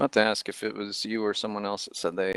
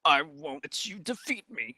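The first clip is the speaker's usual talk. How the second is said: goblin voice; 6 semitones higher than usual. Retold or imitated. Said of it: "I like that goblin".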